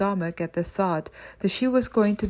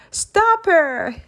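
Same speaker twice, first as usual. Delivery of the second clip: higher, louder screamed order; 10 semitones higher, 6 dB louder than usual. Acted. Mister Creeper screaming as Amelia escapes, loud scream. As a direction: scream it as she flees. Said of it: "screamed Mister Creeper".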